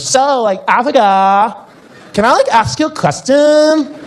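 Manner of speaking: put-on high, airy surfer girl voice; American girl. Beach girl asking the comedian a question, high and airy. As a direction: play the ditzy beach girl. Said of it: "and she goes".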